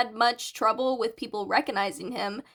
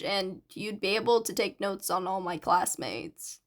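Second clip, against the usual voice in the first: a hint of a lower, quieter teen boy; lightly voiced shy boy; 4 semitones lower, 4 dB quieter than usual. Narrator voices Hitoshi, lower.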